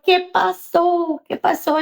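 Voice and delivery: high, stretched, anxious question; retold worry. Mother to crying daughter on the phone, worried. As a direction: re-enact an alarmed parent asking.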